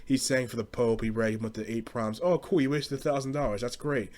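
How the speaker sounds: normal talking voice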